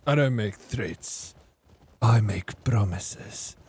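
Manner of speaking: slow, drawn-out mimicked accent; imitating DK's accent. Streamer answering a threat question in DK's accent, slow. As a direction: put on DK's accent, spacing out the words. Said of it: "I'm saying it in your accent".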